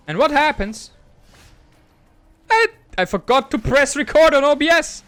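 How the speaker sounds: loud, high-pitched indignant rant; real exasperation. Real, not acted. Streamer confessing he forgot to record, exasperated. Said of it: "Actually, I'm really angry".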